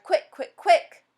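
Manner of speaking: high, loud and rising with urgency; urgent rising shout; urgent cry. A narrator's reading. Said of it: "he cried".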